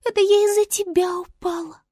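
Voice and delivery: very high little voice; high-pitched star creature. Acted. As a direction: retort in a tiny high voice. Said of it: "сказала звезда".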